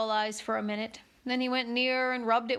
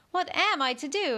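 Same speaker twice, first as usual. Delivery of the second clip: higher puzzled exclamation; exclaiming; 6 semitones higher, 3 dB louder than usual. A narrator's reading. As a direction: cry out in confusion. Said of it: "exclaimed Alice".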